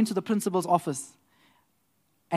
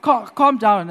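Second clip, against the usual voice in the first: high, loud mock-urgent no's; CEO calming him; 7 semitones higher, 9 dB louder than usual. The CEO urging the excited lawyer to calm down.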